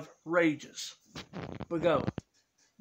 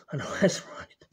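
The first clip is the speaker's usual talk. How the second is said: whisper. Acted. Addict whispers aside.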